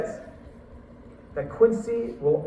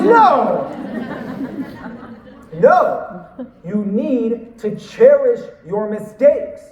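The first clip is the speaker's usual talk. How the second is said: emphatic correction; 4 semitones higher, 8 dB louder, much slower than usual. Retold or imitated. Quincy insists, correcting him.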